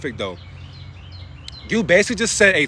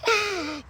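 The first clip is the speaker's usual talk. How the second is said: sharp gasp; 11 semitones higher, 8 dB quieter than usual. Real, not acted.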